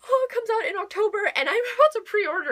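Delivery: high, loud and fast gush; bubbling over with excitement; excited. Real, not acted. Booktuber gushing to viewers about a preorder, high and excited. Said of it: "I am so excited".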